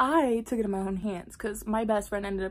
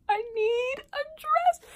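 mock hysterical crying. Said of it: "crying hysterically".

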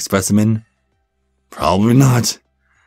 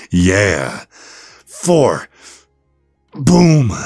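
hyped rep counting; 3 semitones higher, much slower than usual. Acted. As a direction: cheer each rep like a pumped coach. Trainer counting reps for client.

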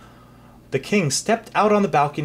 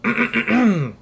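throat clear; 9 semitones higher, 4 dB louder than usual. Acted. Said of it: "bowed stiffly".

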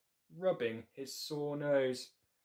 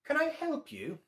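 high, louder bird voice; 5 dB louder than usual. Acted. Mother bird asks the staring bear, high voice. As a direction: pipe it up like a bird. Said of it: "asked the bird".

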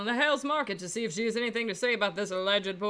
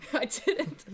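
laughing; 6 semitones higher, 3 dB quieter than usual. Real, not acted. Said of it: "laughing at himself".

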